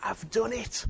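high, ringing "I've done it!"; Jesus crying out in triumph; triumphant cry. Retold or imitated. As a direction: cry it out in victory. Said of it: "And he shouted".

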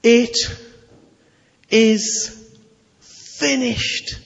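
high, slow, each word set apart; Jesus speaking from the cross, solemn and slow; solemn declaration. Acted. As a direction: let each word hang before the next. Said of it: "When he said this".

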